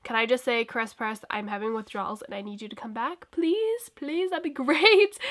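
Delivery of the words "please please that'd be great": playful high begging please; pleading. Pretend. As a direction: beg sweetly, half laughing. Begging shop to come back.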